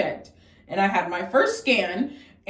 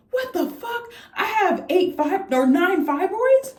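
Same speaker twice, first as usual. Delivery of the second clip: high-pitched, incredulous retold shock; 11 semitones higher, slower than usual. Patient reacting to nine fibroids, shocked high voice. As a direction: pitch it way up in disbelief. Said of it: "I was just so shocked".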